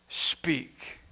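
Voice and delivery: whispered word. Acted. Preacher ends the three steps, whispering. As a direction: breathe it out as a whisper.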